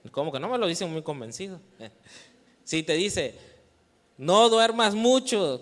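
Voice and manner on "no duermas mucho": mock parent order.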